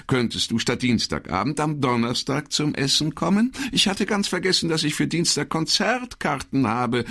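high sing-song woman's voice, fluttering and coy; high twittering voice, gushing over a dinner date; chirping sweetly. Acted. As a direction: play the charmed girlfriend on the phone. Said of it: "zwitscherte sie".

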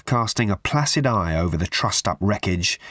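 plain reading voice

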